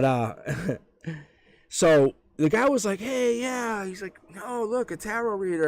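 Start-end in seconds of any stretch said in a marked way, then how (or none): 3.0-3.8 high, drawn-out mock cocky guy. Skeptical guy talking to a tarot reader, mock cocky. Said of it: "he was trying to be cocky". 4.3-5.7 mock cocky, pitched well up. Skeptical guy dismissing a tarot reader, mock cocky. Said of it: "he was trying to be cocky".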